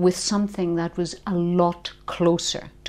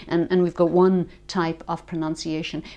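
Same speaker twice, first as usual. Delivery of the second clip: normal talking voice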